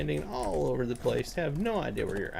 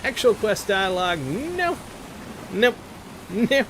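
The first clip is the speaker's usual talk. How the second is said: higher, louder, mock-stern no; emphatic refusal; 8 semitones higher, 4 dB louder than usual. Real, not acted. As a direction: hammer each no home. Ranting about missing voiceovers.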